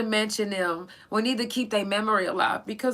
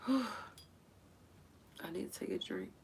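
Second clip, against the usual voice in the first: much softer, whispered through tears; choked whisper; 15 dB quieter, faster than usual. Real, not acted. Pausing the list to recover, choked whisper. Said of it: "can't get past these fucking tears".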